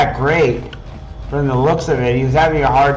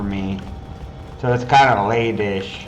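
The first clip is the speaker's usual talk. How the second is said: talking through a yawn; words stretched by a yawn; 5 semitones lower, 6 dB quieter than usual. Real, not acted. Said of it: "That's why I'm yawning".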